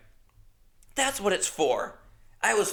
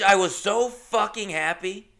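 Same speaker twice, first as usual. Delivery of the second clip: ordinary delivery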